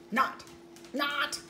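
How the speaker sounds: usual speaking voice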